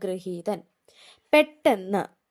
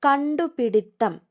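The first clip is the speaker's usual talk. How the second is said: കണ്ടുപിടിത്തം slower and a bit higher; announced word; 3 semitones higher, slower than usual. Shown as a demonstration. Teacher announcing the spelling word, slowed. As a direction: call out കണ്ടുപിടിത്തം slowly and clearly. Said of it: "കണ്ടുപിടുത്തമല്ല".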